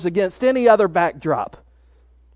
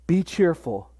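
soft, intimate; 4 semitones lower, 6 dB quieter than usual. Retold or imitated. Preacher voicing Jesus comforting the frightened disciples, softly. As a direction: lower and much softer, gently spoken.

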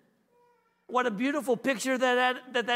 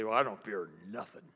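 mock fearless person; 12 semitones lower, 6 dB quieter than usual. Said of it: "one of those that say".

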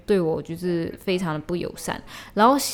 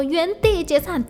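slow, high mock shout of an order; loud command; 8 semitones higher than usual. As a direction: bark it out like a drunk tourist, slowed. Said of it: "他就马上大声说".

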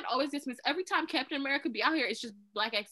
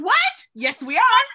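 high, loud disbelieving cry; shocked outburst; 8 semitones higher, 12 dB louder, slower than usual. Retold or imitated. Retelling her tearful reaction, loud and high. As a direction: squeal it like the moment hit you. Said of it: "I said".

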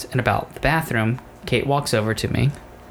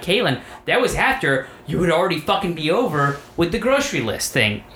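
pitched-up, irritated replay; exasperated accusation; 6 semitones higher than usual. Retold or imitated. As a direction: press the grievance as in the row. Retold quarrel, blaming partner.

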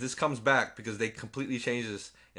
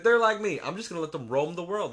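high, louder sarcastic mimicry; mocking the girl; 7 semitones higher, 4 dB louder than usual. Ranting about the clone girl, mocking mimicry. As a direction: parrot her reasoning mockingly. Mocks the girl's reasoning.

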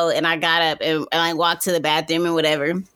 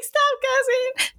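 mock protest; slower than usual. Retold reply to generous cousin.

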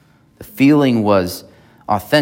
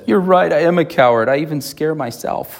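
higher, whiny cowardly lion voice; 6 semitones higher than usual. Retold or imitated. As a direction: play the lion owning up to being a coward, pitched up. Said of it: "And he says".

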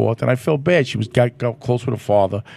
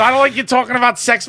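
mock daughter; 10 semitones higher than usual. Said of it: "my daughter".

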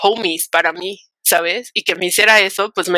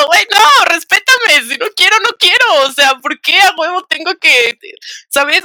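exasperated protest; 10 semitones higher, 6 dB louder than usual. Retold or imitated. Retold protest against forced drinking.

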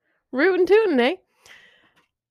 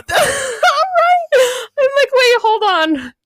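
high squeaky laugh breaking through the words; laughing disbelief; 14 semitones higher, 5 dB louder, much slower than usual. Real, not acted. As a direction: laugh out your disbelief at the scam. Laughing at the goat scam.

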